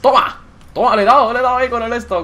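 high, loud cheer of triumph; triumphant shout. Real, not acted. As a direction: crow loudly over your hit. Lands a hit on an opponent.

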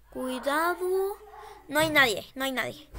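ordinary delivery

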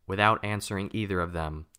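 usual speaking voice

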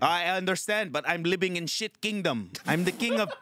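put-on high-pitched, indignant Filipino accent; livid Filipino tenant. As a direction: protest like a fed-up Filipino tenant. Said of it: "Filipino tenant that's fucking just livid".

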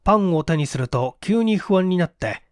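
ordinary delivery, nothing marked

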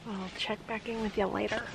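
quiet, hushed talk in a hunting blind; hushed whisper. Real, not acted. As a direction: keep it hushed so the deer don't hear.

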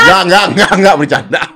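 loud, high, repeated denial with a laugh; joking retraction. Real, not acted. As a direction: hurry to take back the joke, laughing. Hastily takes back his joke.

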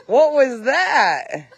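slow, laughing high question. Real, not acted. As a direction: laugh through the words in surprise. Reacting to a startling noise.

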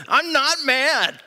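high, slow, forced "not mad"; a hammed-up angry protest; mock angry denial. Shown as a demonstration. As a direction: act the angry dad denying it. Demo of angry demon eyes tone.

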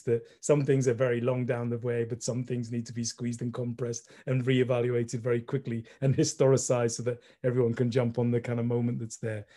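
ordinary delivery

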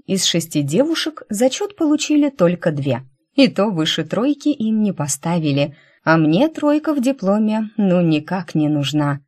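usual speaking voice

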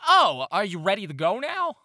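high, loud little gnome voice; Ozgul. Acted. Gnome greeting the group at the door, high and loud. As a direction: pipe up eagerly as a small gnome. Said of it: "this little gnome".

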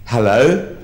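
questioning, rising hello, lightly put on. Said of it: "They sort of go".

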